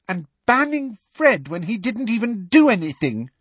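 high, loud, outraged protest; indignant fury at an injustice; furious. A narrator's reading. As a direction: protest furiously, pitch shooting up. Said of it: "said Alicia furiously".

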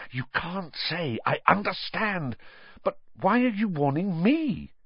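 lightly voiced boy, pleading; a hint of a desperate boy; boy Harry.

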